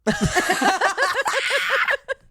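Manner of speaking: squealing high laugh; high burst of laughter. Real, not acted. Laughing at pronunciation.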